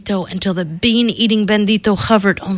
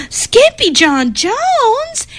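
much higher, slow surprised cry; much slower than usual. Acted. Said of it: "everyone cried".

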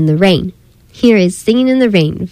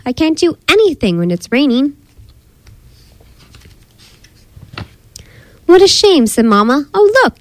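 animated storybook voice, character lines pitched higher; story reading for children; 5 semitones higher, faster than usual. Acted. Narrator reads children a story of Caddy Jane, animated storytelling. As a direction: read a picture book to little ones, quick lively dialogue.

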